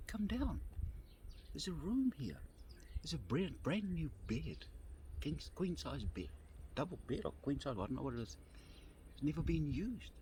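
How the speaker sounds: high-pitched, insistent coaxing; imitating a kindly host's plea; pleading invitation. Retold plea to come indoors.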